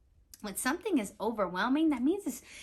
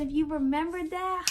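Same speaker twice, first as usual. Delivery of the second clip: ordinary delivery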